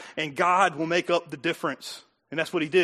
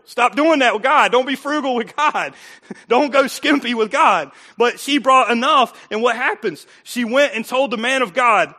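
loud, raised exhorting sermon voice; full-force preaching; 6 semitones higher, 8 dB louder than usual. Real, not acted. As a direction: belt out the exhortation to the congregation.

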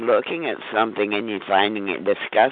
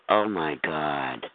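ordinary talk

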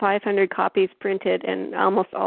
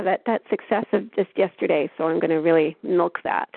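ordinary talk

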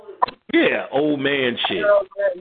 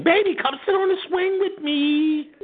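high, sing-song coaxing; sweet girlfriend voice. Retold or imitated. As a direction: coo it like a carefree girlfriend. Girlfriend voiced, cooing invitation.

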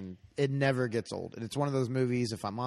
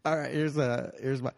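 ordinary delivery

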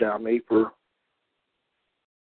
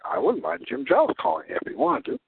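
ordinary talk, nothing marked

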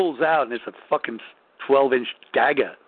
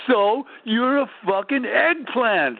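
mocking punchline; 8 semitones higher, slower than usual. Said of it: "He said".

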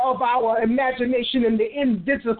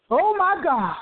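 normal talking voice

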